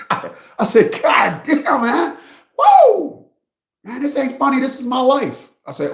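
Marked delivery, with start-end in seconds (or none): 0.0-1.0 breaking into laughter mid-story; laughing. Real, not acted. Telling the client's story, he can't stop laughing. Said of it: "I start laughing again". 1.0-5.5 high, loud, agitated whine; upset client. Retold or imitated. Client protesting to his lawyer, high and loud.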